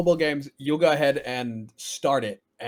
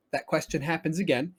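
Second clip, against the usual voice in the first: normal talking voice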